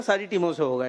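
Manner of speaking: normal talking voice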